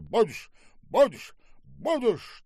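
loud, high barked repetitions, each on its own; furious insistence. Acted. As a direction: hammer each word out. Штаубе repeats order while striking. (яростно, отрывистыми выкриками)

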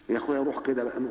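usual speaking voice

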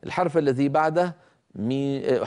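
normal talking voice

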